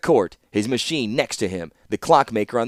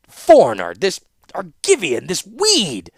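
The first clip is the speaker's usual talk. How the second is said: loud, higher spat insults; bitter outburst; 8 semitones higher, 6 dB louder, slower than usual. A narrator's reading. Warlord railing against the foreign suitor, bitter. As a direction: spit each name with scorn.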